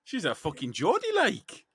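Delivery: light Geordie accent; a hint of Geordie accent. Shown as a demonstration. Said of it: "that's the best Geordie accent I can do".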